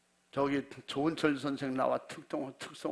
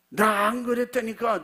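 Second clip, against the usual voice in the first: indignant denial; 6 semitones higher, 7 dB louder than usual. Pretend. Imagined pastor denying it.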